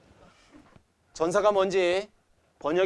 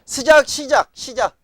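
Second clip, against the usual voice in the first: high, loud call to grab attention; emphatic call; 9 semitones higher, 9 dB louder, slower than usual. Real, not acted. Teacher to class, calling out the key word loudly. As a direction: sing out the word to wake the class up. Teacher calls out to start the point.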